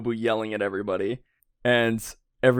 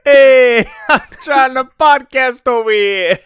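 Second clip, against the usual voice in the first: loud, much higher laughing yell; playful fake-angry shout over a co-host; mock shout; 12 semitones higher, 12 dB louder than usual. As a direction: bellow it in fake outrage while laughing. Mock complaint about being interrupted.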